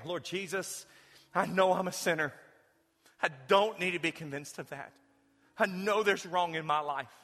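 earnest prayer for the congregation to repeat. Acted. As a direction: lead the prayer phrase by phrase. Leads sinner's prayer.

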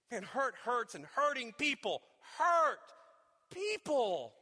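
high, loud preaching voice; fervent preaching. Acted. Preacher picturing the other wounded person, fervent preaching. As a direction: preach it out, pleading.

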